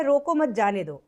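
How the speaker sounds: "रोको मत जाने दो" read straight through as one unpunctuated phrase; no pause. Shown as a demonstration. Teacher reads the unpunctuated sentence to students, no pauses. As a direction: run रोको मत जाने दो together with no break. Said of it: "इसमें कोई विराम चिन्ह नहीं लगा है".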